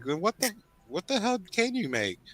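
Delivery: high-pitched, exasperated quoted demand; exasperated retelling. Retold or imitated. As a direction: retell your fed-up question, pitch jumping up. Retold exasperated Taco Bell exchange.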